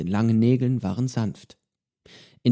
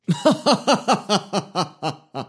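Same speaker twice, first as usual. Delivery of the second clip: broad hearty pirate laugh, high; booming laugh; 9 semitones higher than usual. A narrator's reading. Johnny laughing at Pedro's solemn praise, broad hearty laugh. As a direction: let a big laugh roll out. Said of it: "sein breites Lachen ertönen".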